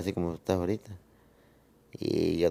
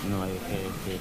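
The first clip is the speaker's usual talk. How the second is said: barely audible, softer voice; hushed, low-volume reply; very low murmur; 6 dB quieter than usual. Real, not acted. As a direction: say it very quietly, almost to yourself. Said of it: "se escucha muy bajito que dice".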